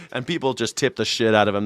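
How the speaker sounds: normal talking voice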